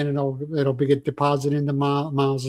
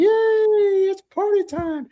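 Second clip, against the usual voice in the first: much higher, squealing "Yay" of mock delight; mock cheer; much slower than usual. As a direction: cheer it like a kid at a party. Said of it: "Miles will be like".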